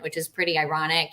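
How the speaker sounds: ordinary delivery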